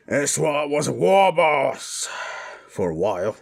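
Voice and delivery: big proud orc bellow; proud boast. Acted. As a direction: boom it out like an old war boss. Orc boasting of war boss past.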